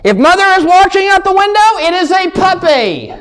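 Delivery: high, careful mock child voice; kid's excuse. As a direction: insist it is a puppy for mother. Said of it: "I'm like".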